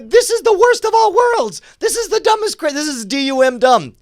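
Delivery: high, loud exasperated rant; mock outrage. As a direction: wail in over-the-top indignation. Mock outrage at the show plan.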